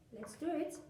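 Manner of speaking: ordinary talk, nothing marked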